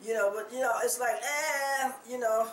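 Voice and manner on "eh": slow, drawn-out disgusted eh. Retold or imitated. Preacher reacting to vomit video, slow disgusted eh. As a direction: pull back from the gross sight.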